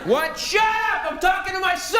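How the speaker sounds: high, shouted "Shut up"; drunk dad yelling. Retold or imitated.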